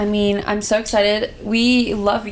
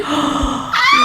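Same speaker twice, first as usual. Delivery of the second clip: mock shocked gasp; 5 semitones higher than usual. Sisters react to a twenty-first birthday, mock shocked gasp.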